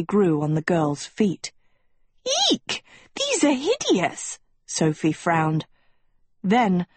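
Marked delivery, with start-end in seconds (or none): 2.5-4.4 appalled squeal. A narrator's reading. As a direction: squeal in disgust at ugly shoes. Disgusted at iron shoes appearing.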